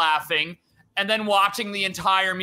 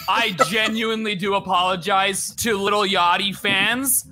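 ordinary talk, nothing marked

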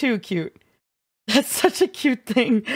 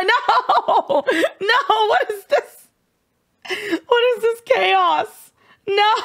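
high, slow, laughing whine; mock-despairing laugh at the chat; 8 semitones higher, much slower than usual. Real, not acted. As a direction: crack up at the chaos, drawn out. Overwhelmed by chat chaos.

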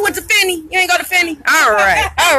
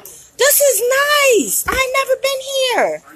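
much higher, thrilled and impressed; excited delight; 11 semitones higher than usual. Real, not acted. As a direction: gush about the new place. Host entering the barbershop, impressed.

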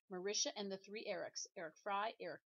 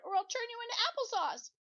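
shrieking, far higher and louder; shout; 10 dB louder than usual. Acted. The teacher attacked by apples, shrieking stop. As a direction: scream it to halt them. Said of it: "she shouted".

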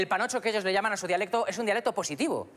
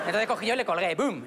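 ordinary talk

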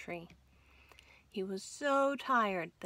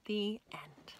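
whispered ending; 5 dB quieter than usual. A narrator's reading. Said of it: "whispered story ending".

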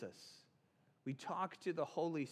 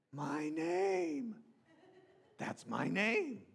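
Gollum; 5 semitones higher, much slower than usual. Acted. Hearing his own name called, Gollum-like wonder. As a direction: marvel at it, drawing the words out. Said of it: "Gollum hears his name. It is a special moment".

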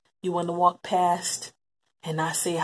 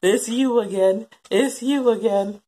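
weary groan; 5 semitones higher, 8 dB louder, much slower than usual. Retold or imitated. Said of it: "you was always just like".

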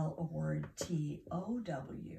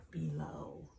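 "below" whispered softly; 2 semitones lower, 3 dB quieter than usual. Shown as a demonstration. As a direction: breathe "below" without voice. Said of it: "model word whispered".

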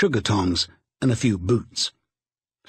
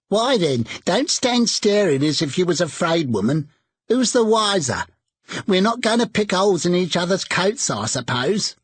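defiant charwoman; 7 semitones higher than usual. A narrator's reading. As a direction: toss it out boldly.

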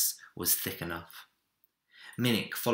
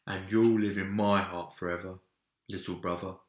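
tender farewell. A narrator's reading. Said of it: "said Big Bear".

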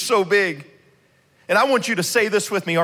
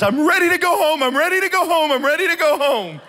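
higher, imitating a tearful child; tearful plea; 8 semitones higher, 3 dB louder than usual. Scared daughter Sage begging dad to go home, mock tearful. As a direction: beg to leave, mock crying. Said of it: "She breaks down in tears and says".

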